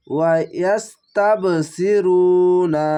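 ইয়াস্তাবসিরুনা said slowly, with a bounced ব; qalqala bounce. Shown as a demonstration. Teacher shows the qalqala in ইয়াস্তাবসিরুনা slowly. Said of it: "কলকলা ধাক্কা মেরে নিচে sound আসবে".

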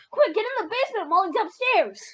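high-pitched, urgent order; urgent call. Pretend. Player urging a friend into the basement, urgent high call.

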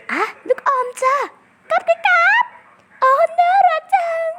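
squeaky granny voice. Pretend. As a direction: hand over candy like a cartoon granny. Granny character giving candy. (เสียงยายการ์ตูนแหลมสูงหวานเจี๊ยบ)

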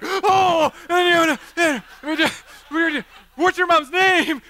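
high, loud, stammering panic; hammed-up flustered yell; flustered shouting. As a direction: splutter loudly, trying to get back on task. His flustered shouted reaction, acted.

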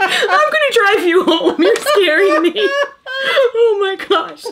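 giggling while talking; laughing. Real, not acted. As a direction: laugh through the words. Laughing hard at mom.

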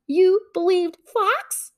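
high, slow incredulous question; can't believe it. A narrator's reading. Hare asking Bear in slow disbelief, incredulous.

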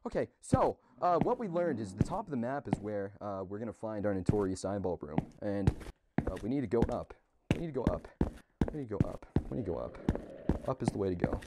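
very soft muttered recap; hushed. Real, not acted. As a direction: keep it down so nothing hears. Hushed recap after the scare.

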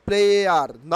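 "player" ending in a sounded R; wrong pronunciation. Shown as a demonstration. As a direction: say "player" as loud "ple-ar". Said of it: "not player". ("player"-এর শেষে র উচ্চারণ করে)

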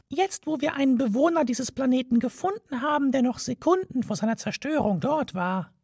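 high, tiny mouse voice, offhand; a mouse brushing off the old man; high mouse voice, smug and offhand; haughty mouse. A narrator's reading.

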